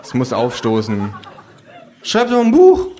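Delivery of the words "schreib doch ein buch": sehr hohe, laute Figurenstimme; laut mit der Idee herausplatzen; Känguru. Acted. Känguru macht Vorschlag. (hoher, lauter Vorschlag)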